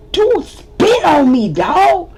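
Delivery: high, loud, slow outraged complaint; indignant yell. Retold or imitated. The punching guy justifying himself to the group, loud and indignant. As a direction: call him out, outraged.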